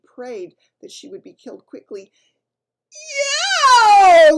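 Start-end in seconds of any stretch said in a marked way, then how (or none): 2.9-4.4 loud, high disgusted yell. A narrator's reading. Bloodbottler tastes the snozcumber, yelling loudly. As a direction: bellow it in disgust. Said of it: "yelled the blood bottler".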